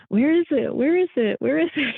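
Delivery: high, choked-up pleading; emotional plea. Retold or imitated. Tired hiker near the summit, emotional high-pitched plea. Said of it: "get a little bit emotional and go".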